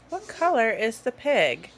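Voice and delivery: ordinary talk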